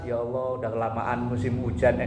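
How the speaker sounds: low, quiet muttered prayer of a parking attendant; lightly voiced casual plea to God; mock prayer. Parking attendant praying under his breath for sunshine.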